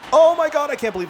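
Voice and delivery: high, loud disbelief; stunned high-pitched exclamation; astonished outburst. Real, not acted. Sudden play works unexpectedly.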